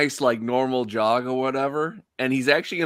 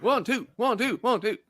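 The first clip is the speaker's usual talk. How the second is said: high-pitched, hyped-up count; jogging drill coach; 12 semitones higher than usual. Acted. Character urges Hux along after guard's advice, hyped-up coach. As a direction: egg him on like a drill leader.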